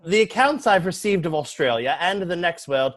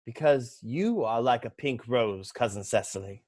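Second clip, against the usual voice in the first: soft compliment; 6 semitones lower, 6 dB quieter than usual. Acted. Romantic compliment to Cecily.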